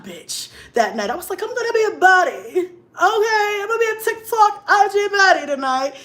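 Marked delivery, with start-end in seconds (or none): 1.4-5.9 high and loud self-hype; hyped up. Retold or imitated. Getting ready for the date, hyping herself high and loud. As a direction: pump yourself up like a baddie.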